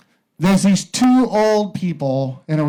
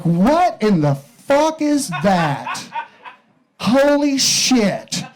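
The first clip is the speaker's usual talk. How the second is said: very slow, much higher, stunned wife; shocked wife; 5 semitones higher, much slower than usual. Acted. Wife seeing the trunk grab a biscuit, drawn-out shock. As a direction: stretch it out in stunned disbelief. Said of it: "And his wife is like".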